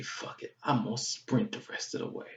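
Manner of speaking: muttered, under the breath; quietly decide to go for it; whispered resolve. Retold or imitated. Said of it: "So I say".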